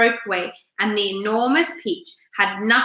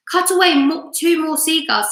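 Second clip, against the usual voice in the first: command; 6 semitones higher than usual. A narrator's reading. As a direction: call out the next order. James commanding the centipede.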